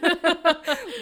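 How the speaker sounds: loud high burst of laughter; real laugh, loud and high; big laugh. Real, not acted. Laughing at her cohost's death-bridge joke.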